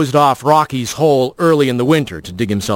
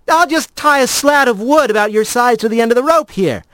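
higher, brisk and casual; a cocky, practical rat; 10 semitones higher than usual. A narrator's reading. Rat character lines.

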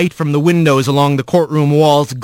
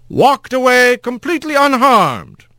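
higher pointed questioning; leading the witness; lawyer voice; 11 semitones higher than usual. A narrator's reading.

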